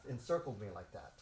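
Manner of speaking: normal talking voice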